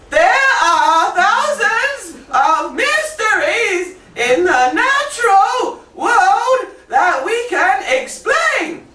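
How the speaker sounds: exaggerated wide-eyed storybook voice; storybook intonation. Shown as a demonstration. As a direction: go as high as you can, like reading to children. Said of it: "That's intonation for children".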